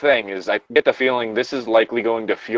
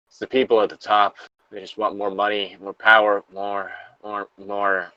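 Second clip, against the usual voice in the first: a lightly acted dying survivor's last words; reading the last entry of the lore log; somber log reading, a mild note of apology; 5 dB quieter than usual. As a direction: read the farewell gravely. Reads the final log entry.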